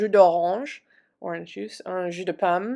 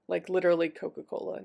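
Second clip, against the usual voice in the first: normal talking voice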